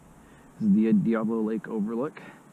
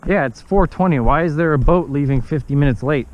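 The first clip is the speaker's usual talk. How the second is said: calling out; 2 semitones higher, 6 dB louder than usual. Real, not acted. Calling out over the lake.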